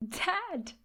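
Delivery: high, delighted shout of Dad; joyful cry. A narrator's reading. Boy greets his returning father, joyful cry. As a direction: burst out with joy at the door. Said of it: "cried Danny".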